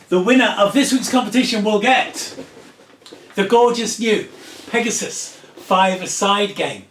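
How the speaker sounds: loud, high announcer build-up; game-show style fanfare; big prize reveal. Real, not acted.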